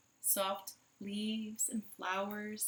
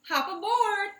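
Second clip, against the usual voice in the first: high, loud farmer call; hearty farmer invitation; Farmer Brown; 12 semitones higher, 10 dB louder than usual. Acted. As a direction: call out cheerfully.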